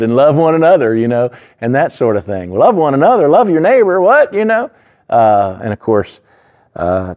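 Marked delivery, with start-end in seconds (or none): 2.5-4.7 higher, louder mock puzzlement; mock bewildered pagan. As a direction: parody outsiders who find it suspicious.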